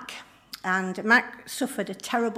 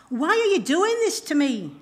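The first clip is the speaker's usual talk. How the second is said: raised, higher cry of protest; acted shout; 7 semitones higher, 5 dB louder than usual. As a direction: shout it back in mild anguish, pitch swooping up. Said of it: "Mac shouts back".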